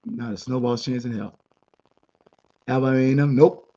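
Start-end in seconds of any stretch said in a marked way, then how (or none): 3.4-3.7 a sharp, louder flat-out no; emphatic nope. Real, not acted. Podcaster rejecting a rival for the classic, loud emphatic no. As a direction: shoot the idea down hard. Rhetorical roll call of rivals.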